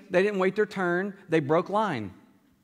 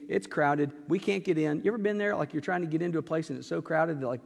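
normal talking voice

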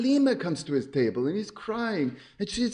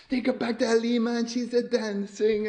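ordinary delivery, nothing marked

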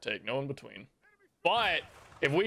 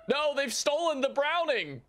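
dismayed outcry; 11 semitones higher than usual. Real, not acted. Enemy took their gun.

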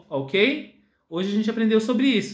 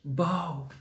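silent mouthing; 3 semitones lower, 7 dB quieter than usual. Shown as a demonstration. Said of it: "Só mexer os lábios".

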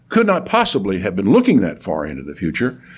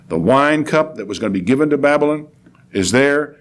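ordinary talk, nothing marked

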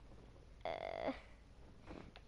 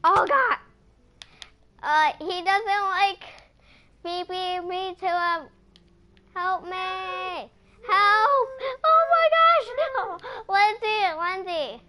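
higher, louder frantic cries for help; panicked yelling; 6 semitones higher, 7 dB louder, slower than usual. Real, not acted. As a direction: shout for help as the bear attacks. Chased by angry polar bear.

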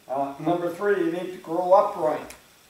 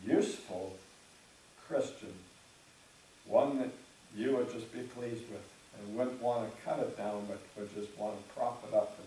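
closing prayer; 5 semitones lower than usual. Acted.

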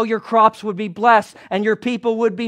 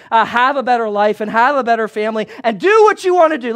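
preaching refrain; 4 dB louder than usual. Real, not acted. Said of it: "preaching refrain builds".

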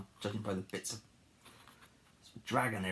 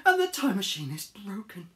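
high, louder fretting; acted alarm; 10 semitones higher, 6 dB louder than usual. Harold discovers the broken time machine, acted dismay. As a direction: wail it anxiously.

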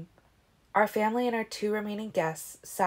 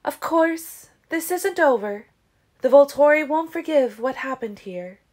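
high, loud grave warning; serious Siobhan; 8 semitones higher, 8 dB louder than usual. Acted. Siobhan warning everyone the Volturi won't forgive, serious. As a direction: warn them gravely. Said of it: "And then she was serious".

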